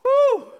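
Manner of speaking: high, loud whoop; crowd-rousing whoop. Real, not acted. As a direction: let out a big whoop. Loud exclamation after punchline.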